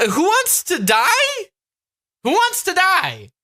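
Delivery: high, drawn-out disbelief; incredulous repeat. Retold or imitated. Viewer echoing the Elmo toy's line, incredulous.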